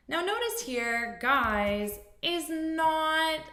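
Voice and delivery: ordinary talk, nothing marked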